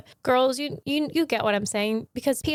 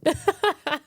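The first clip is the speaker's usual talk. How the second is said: high laugh; 7 semitones higher than usual. Real, not acted. Laugh after joke.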